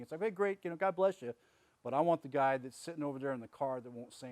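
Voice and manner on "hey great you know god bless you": sarcastic. Retold or imitated. Sarcastic brush-off of complainers.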